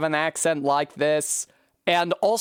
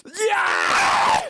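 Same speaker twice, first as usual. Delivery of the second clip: high, loud cry of victory; triumphant yell; 4 dB louder than usual. Real, not acted. Gamer whooping at a successful climb, triumphant shout. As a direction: whoop at making the jump.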